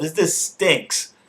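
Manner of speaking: mock complaining. Rollins griping about the title match, mock complaint. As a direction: put on a slow, griping protest. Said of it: "Seth Rollins is complaining".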